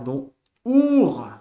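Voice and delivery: "our" called out high and loud; sounded-out word. Shown as a demonstration. Teacher sums up the reading for beginners: a loud, high "our". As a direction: land "our" clearly as the answer. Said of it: "on a our".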